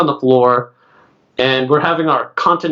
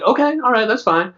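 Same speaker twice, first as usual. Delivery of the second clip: higher-pitched, quick to give in; retold meek agreement, pitched up; placating reply; 8 semitones higher than usual. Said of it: "So I'm like".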